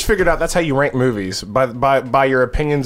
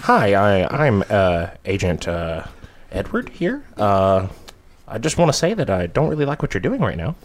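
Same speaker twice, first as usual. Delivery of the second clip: low, halting FBI agent voice; an awkward, analytical agent, hesitant and understated; Mindhunter agent impression; 5 semitones lower than usual. Co-host answering the demand for an impression, low and halting agent voice. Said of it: "Sounds like the Riddler from Gotham".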